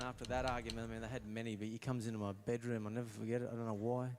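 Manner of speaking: ordinary talk, nothing marked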